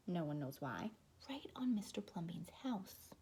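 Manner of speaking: plain reading voice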